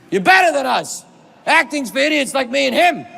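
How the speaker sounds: high, emphatic shouted declaration; Al Pacino. Retold or imitated. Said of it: "Al Pacino says".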